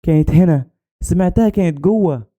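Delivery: high-pitched, mild acted scream; distressed crying out. A narrator's reading. Girl insists to searchers at the tomb, distressed crying out. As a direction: insist desperately, with only a mild cry. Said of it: "فضلت أصرخ وأقول لهم".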